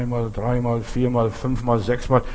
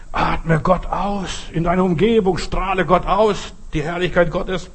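slow uplifted preaching; 5 semitones higher, slower than usual. Acted. Preacher exhorting.

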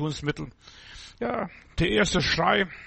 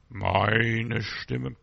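"Meine Stimme" dropped much lower, from deep in the chest; deep voice demo; 7 semitones lower than usual. Shown as a demonstration. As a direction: say "Meine Stimme" low from deep inside the body. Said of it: "kommt aus der Tiefe des Körpers".

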